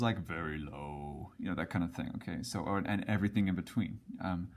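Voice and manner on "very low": slow, deep demo voice; very low pitch. Shown as a demonstration. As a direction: drop low and draw it out. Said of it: "and then low is".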